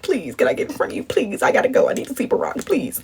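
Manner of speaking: soft, hurried begging; breath-light, urgent mock plea; hushed pleading. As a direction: plead under your breath to cut in. Acted begging to cut in line.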